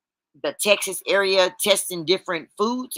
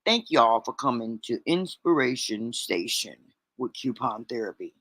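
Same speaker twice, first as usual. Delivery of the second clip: low radio host voice; deep late-night radio host; 6 semitones lower, 7 dB quieter than usual. Shown as a demonstration. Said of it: "maybe I shouldn't have said that in a deep voice".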